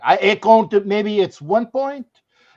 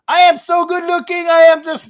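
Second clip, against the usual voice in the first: higher, louder, preening self-praise; mock vanity; 10 semitones higher, 8 dB louder than usual. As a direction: show off like a vain action hero. Mock vanity about his looks.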